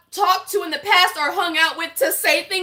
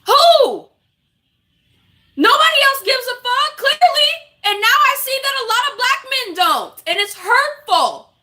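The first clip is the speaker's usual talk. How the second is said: hurt, angry outburst; 9 semitones higher than usual. Real, not acted. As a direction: cry out in indignation, pitched right up.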